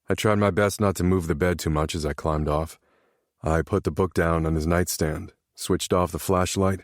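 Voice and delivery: usual speaking voice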